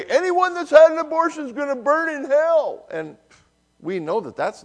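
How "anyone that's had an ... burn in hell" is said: high, mocking condemning voice; judgmental protester. Pastor mimicking protester condemning women. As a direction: mimic a shrill street protester damning people. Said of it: "one woman was heard to say".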